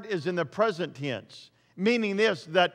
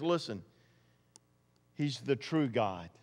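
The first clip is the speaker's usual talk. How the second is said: suddenly soft and lower, intimate; hushed; 6 semitones lower, 8 dB quieter than usual. Acted. Preacher telling the church, softly, who is true God. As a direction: lean in and say it quietly. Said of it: "preacher drops to intimate tone".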